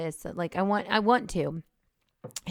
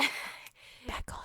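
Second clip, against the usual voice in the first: unvoiced whisper right on the mic; 10 dB quieter than usual. Real, not acted. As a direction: lean in and whisper it. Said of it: "You're really into, like, whispering into the microphone".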